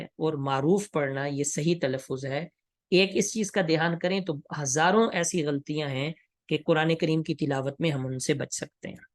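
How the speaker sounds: ordinary talk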